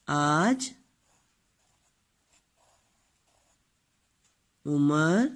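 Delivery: each word spaced out slowly; pausing between words for the pupils to write; slow dictation. Shown as a demonstration. Dictates while writing sentence.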